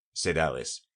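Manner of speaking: normal talking voice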